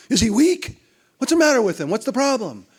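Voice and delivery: high, loud, incredulous demand; loud affronted boss asking what's wrong with him; indignant retort. Retold or imitated. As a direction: fire back as if insulted. CEO quoted reacting to criticism.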